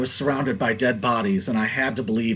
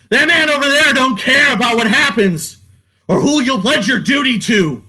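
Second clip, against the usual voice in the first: much higher, strained shout on that man; escalating cry pointing out that man; 11 semitones higher, 6 dB louder than usual. Pretend. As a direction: push the repeat far higher and louder. Repeat climbs to a cry.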